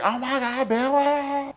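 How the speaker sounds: very high, hammed-up wail; mock distress. Sidney as boyfriend climbs in, high mock distress. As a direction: cry out his name in mock panic. Sidney greets Billy in panic.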